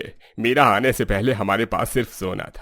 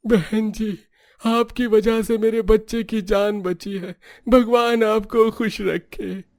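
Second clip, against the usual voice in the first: higher, slow tearful blessing; grateful poor mother; 12 semitones higher, slower than usual. Acted. Poor mother thanks the family for saving her child, higher. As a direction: bless them from the heart.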